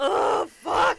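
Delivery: loud, high shouted curse; big comic bellow; relieved swearing outburst. Acted. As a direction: let the swear rip at full volume. Let loose after told not to censor.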